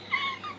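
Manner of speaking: short high giggle. Real, not acted. Squeaky giggle after teasing a viewer. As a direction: let a squeaky laugh out.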